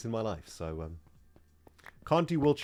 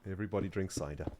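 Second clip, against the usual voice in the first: normal talking voice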